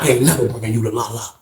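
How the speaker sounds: guard coldly refusing to bring the weed; menacing CO. Retold or imitated. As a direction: lean in and say it dead serious. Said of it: "I seen his eyes".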